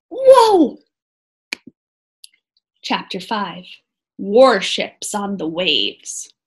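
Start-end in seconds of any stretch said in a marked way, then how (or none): 0.1-0.8 loud high gasp of wonder; amazed whoa. Real, not acted. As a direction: blurt it out in amazement. Reacting to the reveal.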